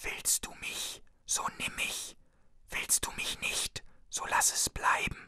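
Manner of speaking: geisterhaftes, langsames Flüstern ohne Stimme. A narrator's reading. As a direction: wie ein Spuk ins Ohr hauchen. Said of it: "die ihm zuflüsterte". (eine Stimme im Wald flüstert dem Ältesten zu, tonlos geflüstert)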